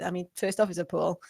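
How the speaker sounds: ordinary talk, nothing marked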